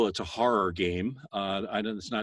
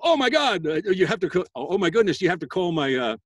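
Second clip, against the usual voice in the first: put-on excited kid; 5 semitones higher, 4 dB louder than usual. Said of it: "you guys your age go".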